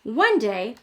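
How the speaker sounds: plain reading voice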